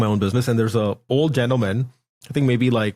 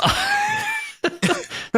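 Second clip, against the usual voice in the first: high laugh; 3 dB quieter than usual. Real, not acted. Laughs at car losing value.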